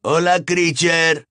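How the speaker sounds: almost shouting. A narrator's reading. Fred greets the house-elf, almost shouting. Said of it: "casi gritando".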